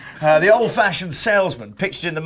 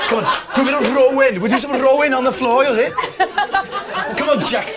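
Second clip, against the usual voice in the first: put-on Welsh lass accent; 5 semitones higher than usual. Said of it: "us Welsh lasses".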